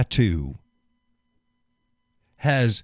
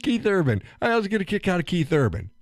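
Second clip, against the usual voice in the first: mock gushing fan; 10 semitones higher, faster than usual. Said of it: "He's like".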